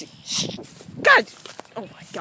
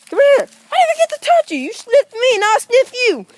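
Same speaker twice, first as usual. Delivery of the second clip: very high, louder sing-song; 11 semitones higher, 5 dB louder than usual. Real, not acted. Man cooing at a stray dog, very high pitched. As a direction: talk like to a puppy.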